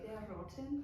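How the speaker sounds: quiet imitation of a Scottish grandma's accent; a light Scottish burr, like grandma would say it. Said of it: "as my Scottish grandma would say".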